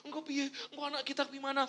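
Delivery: mock panic about the kids; anxious fretting. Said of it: "Malah tambah gini".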